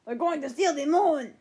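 lightly voiced Gru with a Russian accent; a hint of Gru the villain announcing his plan. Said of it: "a Gru voice accent".

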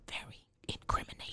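hushed whisper. Real, not acted. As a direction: whisper it ominously. Whispered warning.

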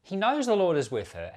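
normal talking voice

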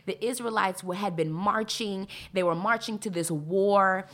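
ordinary talk

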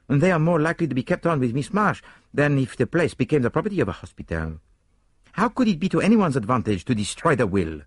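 put-on high, accented detective, emphatic; argumentative Poirot. A narrator's reading. Poirot rejects Hastings's suggestion about the Bakers, high and emphatic. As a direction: reason it out aloud, dismissing the idea.

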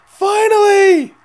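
a long, very high and loud cry, slow; Finally stretched out high and loud; ecstatic yell. Real, not acted. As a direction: shout it like the wait is finally over. Celebrating Dustin's win.

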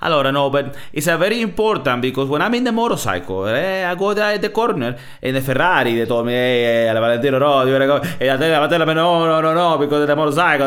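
Valentino Rossi. Retold or imitated. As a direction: rattle on like an animated Italian racer. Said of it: "he always goes back to his Italian".

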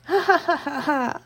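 high unsettling cackle; creepy laugh. Acted. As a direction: laugh like a creepy yandere girl. Creepy laugh after reveal.

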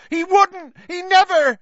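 high loud desperate shout; roaring. A narrator's reading. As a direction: bellow the denial. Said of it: "roared the boy".

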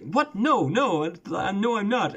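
high, flustered denial; flustered hotel owner. Retold or imitated. Hotel owner to the guest, mock high flustered denial. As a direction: splutter like a caught-out host. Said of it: "And he's like".

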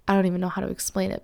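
ordinary delivery

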